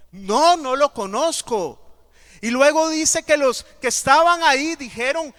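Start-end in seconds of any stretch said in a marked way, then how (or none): 0.1-1.8 louder, slower, pressed denial; second no, louder and dragged out; insistent denial. Acted. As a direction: push the no harder, slower. Peter's second, more insistent denial.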